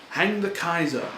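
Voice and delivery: loud, high rallying cry; proclaiming slogan. Retold or imitated. Reading the hateful poster aloud to the audience, a loud rallying cry. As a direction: shout it like a war poster slogan.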